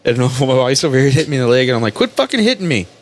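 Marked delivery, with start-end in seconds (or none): 1.9-2.9 high-pitched, annoyed retort; irritated snap. Acted. Husband telling his wife to stop hitting him, irritated snap. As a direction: act fed up, pitch jumping up.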